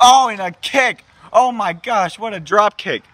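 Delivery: high ringside exclamation; excited play-by-play. Acted. Commentator reacts to a drop kick, high excited call. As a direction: burst out at the impact.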